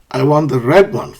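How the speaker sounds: "red" stressed over the other words; contrastive stress. Shown as a demonstration. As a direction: pick out "red" as the chosen one. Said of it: "using contrastive stress".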